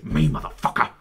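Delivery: put-on Dad threatening a cheeky teen, low; mock tough dad. Dad's menacing reply.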